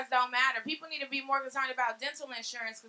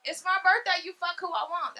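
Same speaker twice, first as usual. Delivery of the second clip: shouted line; 5 semitones higher, 3 dB louder than usual. Retold or imitated. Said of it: "That's how the song go".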